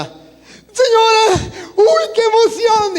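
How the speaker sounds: very high, loud, slow joyful cry; overjoyed. Acted. Lost man greets the old woman who found him, overjoyed high cry. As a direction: burst out with relief and delight. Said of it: "él se emociona".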